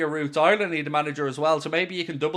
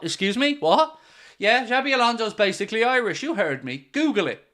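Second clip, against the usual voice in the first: normal talking voice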